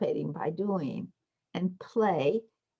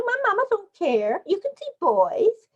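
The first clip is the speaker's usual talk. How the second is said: put-on much higher, defiant little girl; child's voice; 14 semitones higher, 4 dB louder than usual. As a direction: answer back like a sassy preschooler. Alicia's reply in play.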